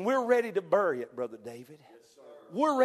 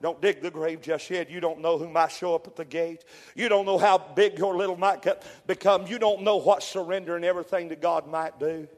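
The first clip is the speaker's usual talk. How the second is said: loud, ringing sermon delivery; preaching at full force; 4 semitones higher, 9 dB louder than usual. Acted. Preacher exhorting his church to surrender, full force. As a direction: preach it out to the congregation.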